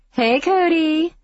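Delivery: high, slow sing-song greeting. Acted. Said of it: "Mindy calls in a sing-song voice".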